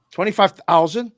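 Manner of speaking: high, loud disbelieving question; incredulous. Retold or imitated. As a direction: imitate an astonished wife. Wife reacts to payout claim.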